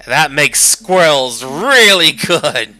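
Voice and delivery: loud, slow, thrilled shout; overjoyed about the squirrels; excited. Real, not acted. Excited discovery.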